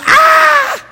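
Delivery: very high, loud mock shriek; a shocked scandalized cry; horrified gasp-shriek. Preacher acting the Pharisees' outrage, high mock shriek. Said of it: "They went".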